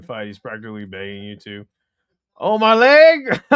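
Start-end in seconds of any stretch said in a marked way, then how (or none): 2.4-3.4 loud, slow hammed-up pain; mock pained cry. Mocking the injured fighter, a hammed-up loud cry. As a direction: overplay the injured fighter yelling.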